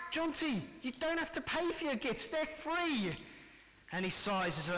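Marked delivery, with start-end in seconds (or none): 0.1-3.1 higher, bright telling-off-free dad to his son; cheerful dad. Pretend. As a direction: tell your son gladly the gifts cost nothing. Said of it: "And we say to him".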